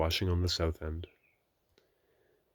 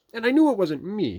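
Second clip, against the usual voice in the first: Rabbit; 9 dB louder than usual. A narrator's reading. Rabbit voiced in Pooh story.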